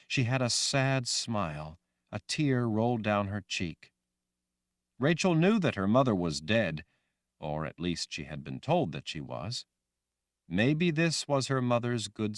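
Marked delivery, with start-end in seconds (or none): none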